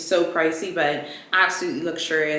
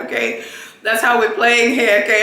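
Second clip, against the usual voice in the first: sassy retort; 5 semitones higher, 9 dB louder than usual. Retold or imitated. Retorting to possessive friend.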